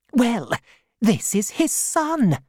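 put-on high old aunt voice; aunt introducing the boy; aunt explaining. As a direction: announce it briskly.

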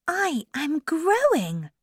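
high, light character voice; Alice. Acted. Alice noticing a change in herself, high voice. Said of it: "she thought".

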